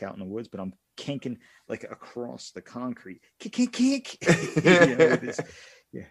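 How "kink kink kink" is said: high loud imitated clinks; playful sound effect; rock skipping noise. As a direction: squeak out the sound of rocks bouncing. Imitating rocks skipping on concrete.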